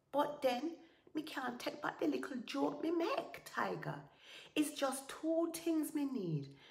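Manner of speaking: high, thin, wheedling voice; feigned-weak pleading. Acted. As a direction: wheedle him plaintively. Anansi pleads, feigning weakness.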